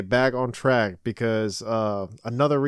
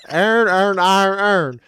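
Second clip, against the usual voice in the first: higher, louder mock Baltimore accent; 6 semitones higher, 5 dB louder than usual. Repeating the Baltimore video line, in a mock Baltimore accent. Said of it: "we really talk like that".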